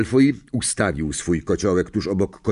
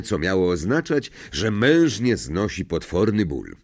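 ordinary delivery, nothing marked

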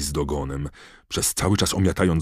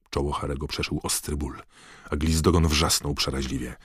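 ordinary delivery, nothing marked

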